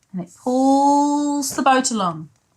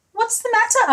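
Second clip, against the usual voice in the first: high, gentle asking voice; high kind question; 12 semitones higher, faster than usual. Acted. Giant asking the sniffing giraffe, kindly high.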